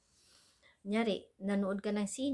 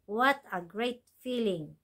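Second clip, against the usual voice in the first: slow model phrase. Shown as a demonstration. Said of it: "오케이".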